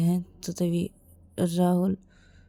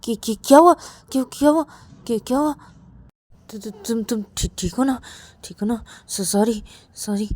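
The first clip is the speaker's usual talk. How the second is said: worried stammer; 4 semitones higher, 5 dB louder, faster than usual. Acted. Rahul fears he hurt Ravi, flustered and stammering. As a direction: stumble anxiously over each question.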